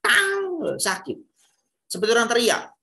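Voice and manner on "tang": high sharp tang hitting the ear; sharp ping. Shown as a demonstration. Coach imitating a harsh frequency striking the eardrum. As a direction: spit out a sudden high tang. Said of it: "frekuensi itu langsung nih".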